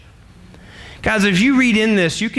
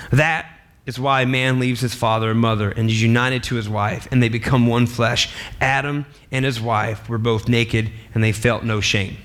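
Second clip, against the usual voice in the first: lower, measured Bible reading; scripture reading; 7 semitones lower than usual. Acted. Preacher reading Genesis to the congregation, solemn and measured. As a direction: read the verses out with solemn weight.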